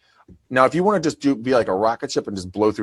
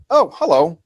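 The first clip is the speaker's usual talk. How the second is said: bright phone greeting; 7 semitones higher, 4 dB louder than usual. Retold or imitated. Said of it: "I'm like".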